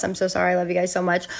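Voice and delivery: ordinary delivery, nothing marked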